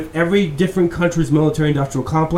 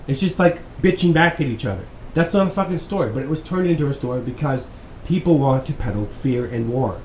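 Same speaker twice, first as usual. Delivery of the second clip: normal talking voice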